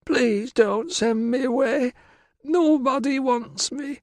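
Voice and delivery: very high, slow, plaintive wail; tearful pleading. Acted. Virana begs Tilla not to send her away, tearful. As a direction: draw it out like a girl about to cry. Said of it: "Verana's lower lip began to tremble".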